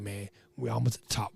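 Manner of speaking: low, soft recalled aside; hushed aside. Retold or imitated. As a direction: say it low, as if to a buddy just ahead. Quiet aside recalled from the slide ladder.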